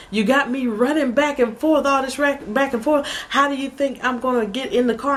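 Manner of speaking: high, complaining imitation of husband; exasperated husband, retold. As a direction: mimic a husband fed up with running around. Voices exasperated husband in argument.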